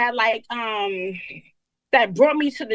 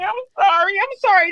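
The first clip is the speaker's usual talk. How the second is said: tearful apology; 7 semitones higher, 4 dB louder, slower than usual. Real, not acted. Caller apologizes while overwhelmed.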